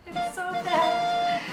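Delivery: slow and high through laughter; laughing. Real, not acted. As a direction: laugh out the words. Laughing at her own bad joke.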